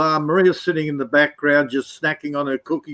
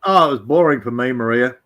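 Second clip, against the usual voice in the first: ordinary delivery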